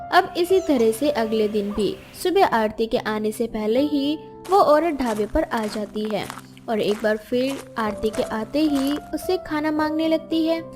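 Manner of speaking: usual speaking voice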